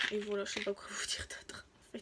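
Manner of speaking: breathy trailing whisper. Real, not acted. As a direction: whisper it, at a loss.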